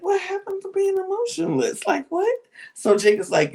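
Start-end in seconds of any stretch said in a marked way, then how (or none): 0.0-2.3 high-pitched incredulous questioning; mock disbelief. Players doubt emotionless Linda, imitated disbelief. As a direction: squeak out an imitation of baffled onlookers.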